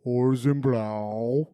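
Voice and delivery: slow, drawn-out British accent; exaggeratedly slow British attempt. Shown as a demonstration. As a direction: stretch it out in a put-on British accent. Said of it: "I wanna hear [name]".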